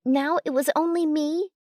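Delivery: ordinary delivery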